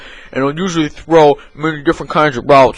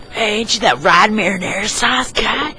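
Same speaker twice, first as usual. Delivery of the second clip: high voice that drops softer; high pestering fan; 8 semitones higher, 5 dB quieter than usual. Acted. Fan telling the coach he should be coaching, high and soft.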